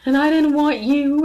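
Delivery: high, loud, drawn-out mock sobbing; mock wailing. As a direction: wail it slowly, like a hammed-up crying woman. Mother's sobbing complaint, acted out.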